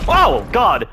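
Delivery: sudden loud, high cry of alarm; startled shout. Real, not acted. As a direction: yelp as you get caught off guard. Sudden enemy attack in game.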